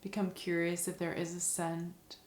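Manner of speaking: soft, calm meditation voice with long pauses; guided meditation. Acted. Teacher guiding listeners through a five senses meditation, soft and calm.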